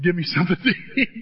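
fast, laughing retelling; laughing at the quote. Retold or imitated. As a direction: crack up mid-line as you repeat it. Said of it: "I didn't know it was that simple".

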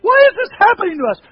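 high, loud, pleading cry; tearful wife. Retold or imitated. Wife in tears on the phone, high loud plea. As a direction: wail it as a wife in distress. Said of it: "And she's in tears".

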